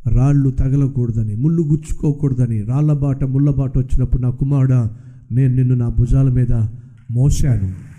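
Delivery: God telling his son he carried him on his shoulders. Acted. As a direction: speak low as a father who carried his son. God explains carrying him.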